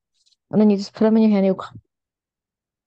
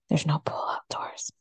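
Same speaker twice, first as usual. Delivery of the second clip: whisper; 5 semitones lower, 9 dB quieter than usual. Real, not acted. Dramatic aside about pools.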